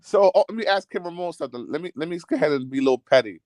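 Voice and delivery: normal talking voice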